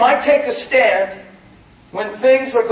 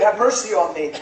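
restrained mock yell, not raised; retold cry. Preacher retelling blind Bartimaeus calling to Jesus, restrained cry. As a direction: call out for mercy at an ordinary preaching volume. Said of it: "he's yelling loud".

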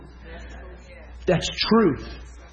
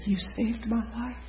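imitated tearful gratitude; 7 semitones higher, 11 dB quieter than usual. Healed woman thanking the minister, imitated soft and tearful. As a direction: say it small and high, choked up. Woman's grateful words acted out.

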